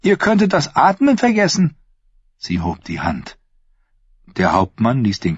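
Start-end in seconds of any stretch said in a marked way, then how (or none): 0.0-1.7 a touch of biting mockery in the niece's higher voice; Thyene's voice. Thyene taunting her uncle the prince, mildly mocking. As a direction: needle your uncle with sweet sarcasm.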